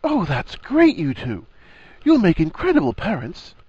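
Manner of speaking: delighted, high-pitched congratulations; bright, grinning. A narrator's reading. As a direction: burst out grinning, voice leaping up. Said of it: "Harry's grin was bright and immediate".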